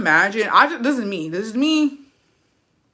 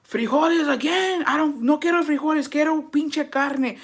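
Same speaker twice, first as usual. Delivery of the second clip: higher, put-on voice of a drunk husband complaining about beans; drunk husband's voice; 10 semitones higher than usual. Drunk husband home from the bar demanding meat, higher put-on voice. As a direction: play the drunk husband whining for meat, slowing at the end. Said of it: "He's like".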